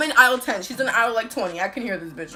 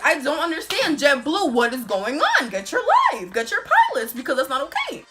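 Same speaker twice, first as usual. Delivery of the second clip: loud, punchy indignant complaint; exasperated rant; 4 semitones higher, 5 dB louder than usual. Real, not acted. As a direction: call the airline out with rising swoops. Ranting at the airline over delay.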